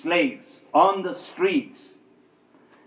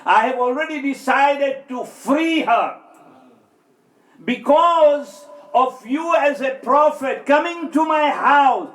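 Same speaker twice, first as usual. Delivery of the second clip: higher and louder, with a near-shout; emphatic restatement; 9 semitones higher, 5 dB louder than usual. Retold or imitated. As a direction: lift it into a proud declaration. Man's reply restated with full force.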